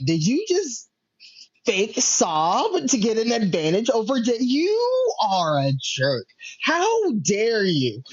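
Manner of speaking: high-pitched, drawn-out indignation; mock outrage. Fan calling out Roman for fake sobbing, mock outrage. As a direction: scold the villain in hammed-up disbelief.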